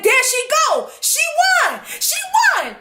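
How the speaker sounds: high, hollering hype-man voice; excited shouted announcement. Retold or imitated. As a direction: imitate a boy cheering her win. Excited boy announcing her win to everyone.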